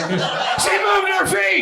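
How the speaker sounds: very high, very slow delighted cry; excited shout. Real, not acted. Comic thrilled an audience member moved her feet, high delighted shout. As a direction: crow it out in disbelief, drawn long. Crowd member obeys joke.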